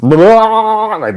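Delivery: high, stretched-out blurted noise; imitated drawn-out wail. Fan mimics his own reaction to the wild match, drawn-out high wail. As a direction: let out a long high wail, mimicking yourself. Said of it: "I mean, I was just".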